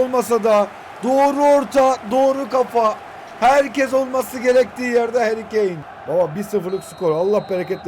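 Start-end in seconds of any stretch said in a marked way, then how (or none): none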